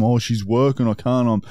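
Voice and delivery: ordinary talk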